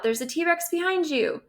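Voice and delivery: high, urgent warning about the T-Rex; alarmed warning. Acted. Reading an alarmed warning quote.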